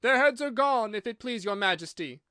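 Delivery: high, loud soldiers' report; shouted reply. A narrator's reading. Soldiers reporting to the Queen, shouted reply. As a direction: call it out, easing off toward the end. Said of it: "the soldiers shouted in reply".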